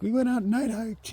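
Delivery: high, loud mock boasting; mocking imitation. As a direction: parody proud beginners in a high put-on voice. Mocks the hikers' boast.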